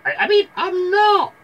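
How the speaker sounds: loud, very high fake girl voice; wolf hastily putting on a girly falsetto; wolf faking Red. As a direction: correct yourself into a squeaky falsetto. Wolf corrects to Red's voice.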